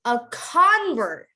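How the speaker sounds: "convert" as a slow, long CON-vert; American noun "convert", front-stressed; stress on first syllable. Shown as a demonstration. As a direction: stretch and punch CON in "convert". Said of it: "you want to emphasize the beginning".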